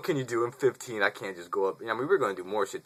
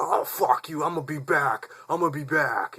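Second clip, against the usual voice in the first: higher, louder imitated threat; angry parting threat; 7 semitones higher, 4 dB louder than usual. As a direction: promise revenge while driving off.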